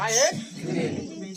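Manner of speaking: drawn out, word by word; slow model sentence. Shown as a demonstration. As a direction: say it slowly for the class to repeat. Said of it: "সবাই pronounce কর".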